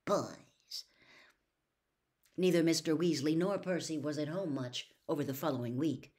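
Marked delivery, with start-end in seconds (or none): 0.1-0.8 whispered under the breath; muttered. A narrator's reading. Hermione leaving the room, muttering under her breath. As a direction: mutter it scornfully to yourself. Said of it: "muttering something which sounded very much like".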